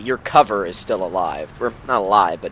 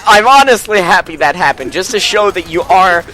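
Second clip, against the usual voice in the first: gleeful teasing; 5 semitones higher, 7 dB louder than usual. Real, not acted. Gloating after teammate's death.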